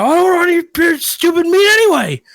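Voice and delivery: high, loud, fast huffy yell; petulant high shout at the butcher; retold screaming match. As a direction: fling it back mid-argument. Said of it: "I got into a screaming match".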